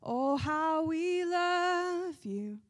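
slow, drawn-out worship croon; worship leader. Pretend. Worship leader crooning to the congregation, slow and drawn out.

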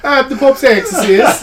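put-on high, loud comic Italian accent; jokey Italian movie-priest voice; mock Italian accent. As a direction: ham up an Italian accent through laughter. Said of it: "he's doing this Italian accent".

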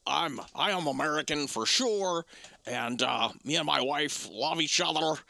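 lightly put-on shaky American accent as Gerard Butler; a hint of a Scot's wobbly American accent; mock Gerard Butler American accent. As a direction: imitate a Scotsman faking an American accent. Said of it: "That's what he sounds like to me".

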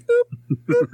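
high imitated banjo plucks; boop noises. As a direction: pluck it out like a one-string banjo. Mimicking banjo twang.

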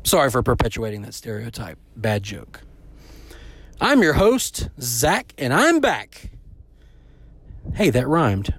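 ordinary talk

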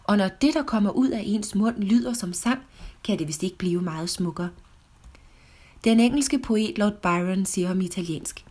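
ordinary delivery

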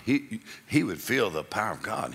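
ordinary talk

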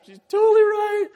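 loud, very high amused agreement; hammed-up high squeal of agreement; imitated self-mocking laughter. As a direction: act out cracking up at yourself. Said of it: "that dude just started laughing".

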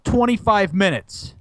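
ordinary talk

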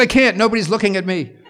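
high, loud retort to the director; loud protest. Retold or imitated. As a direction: yell back that it's impossible. Conductor yells back at the director.

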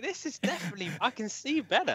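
high, delighted exclamation; mock delighted. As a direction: sound thrilled with the result. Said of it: "I was like".

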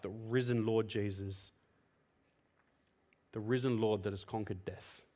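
ordinary talk, nothing marked

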